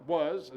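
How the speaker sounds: the rejected past tense, stressed; stress on was. Shown as a demonstration. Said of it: "It's not".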